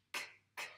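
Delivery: "k" as a bare voiceless click; isolated k sound. Shown as a demonstration. Teacher isolating the sound for a pupil, bare "k". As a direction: say "k" alone, no vowel after it. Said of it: "on entend bien le son k".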